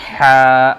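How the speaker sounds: حا breathed loud from the throat; throaty حا. Shown as a demonstration. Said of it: "حلق سے".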